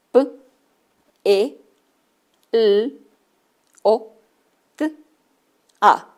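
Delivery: P, E, L, O, T, A sounded out one by one; letter sounds. Shown as a demonstration. Teacher sounding out pelota letter by letter. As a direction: sound out each letter with a pause. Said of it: "¿qué sonidos diríamos que son?".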